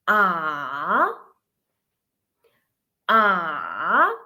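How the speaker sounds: long drawn AH that dips and swings up; third tone, dipping. Shown as a demonstration. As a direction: sink down on AH, then sweep upward. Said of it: "fallen and then rising".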